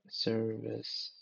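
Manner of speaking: ordinary talk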